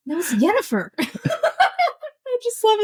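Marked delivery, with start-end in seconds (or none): 0.1-0.9 imitating a correction, "Yennefer" with a Y, higher; high, amused correction landing on "Yennefer" with a Y; corrective Yennefer. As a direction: correct it to YEN-uh-fer, "Yennefer". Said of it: "thinking of Conan O'Brien's".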